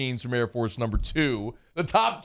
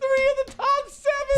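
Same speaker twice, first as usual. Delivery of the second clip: excited; 3 dB louder than usual. Real, not acted. Hyping the rankings stat.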